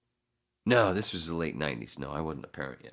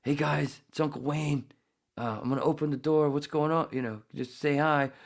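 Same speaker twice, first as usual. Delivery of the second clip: high, sing-song uncle talking to toddlers; high friendly baby-talk tone; gentle uncle voice; 11 semitones higher, 4 dB louder than usual. Retold or imitated. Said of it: "and I go".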